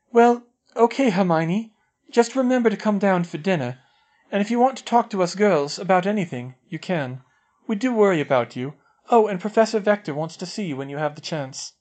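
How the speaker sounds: put-on a girl reassuring her upset friend; kind schoolgirl. A narrator's reading. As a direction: comfort her before leaving. Parvati reassuring through door.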